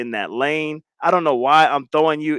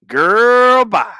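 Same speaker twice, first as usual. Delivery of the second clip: sassy dismissal; 6 semitones higher, 6 dB louder than usual. Acted. Dismissing a chatter.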